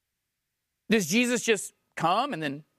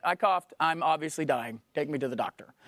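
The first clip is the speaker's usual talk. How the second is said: mock dying whine, fading quieter and lower; mock hypochondriac; 8 semitones lower, 3 dB quieter than usual. Preacher mocking people who rush to the doctor, mock dying fade. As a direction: play the melodramatic patient, sinking away. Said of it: "You're like".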